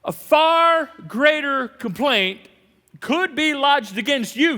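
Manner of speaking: slow, loud, high preacher's warning; dramatic warning. Acted. Preacher warning the church, slow and loud. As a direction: bear down slowly on the warning.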